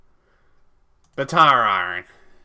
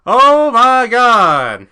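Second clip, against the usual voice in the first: drawn out, high loud oh my God; shocked cry; 10 semitones higher, 15 dB louder, much slower than usual. Real, not acted. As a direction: wail it slowly in disbelief. Shocked by restart.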